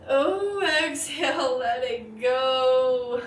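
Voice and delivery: loud, drawn-out release on the exhale; loud and slow, letting go; big release. Real, not acted. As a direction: let it all go with a loud sigh of words. Big release on final breath.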